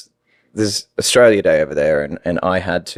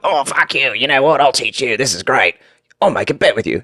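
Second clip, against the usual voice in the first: put-on Australian accent; 4 semitones higher than usual. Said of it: "I kept doing that to my friends".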